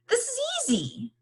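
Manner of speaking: robot Bot. A narrator's reading. Robot Bot proudly tells Rob, high and quick. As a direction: chirp it out high and fast like the robot. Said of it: "Bot said".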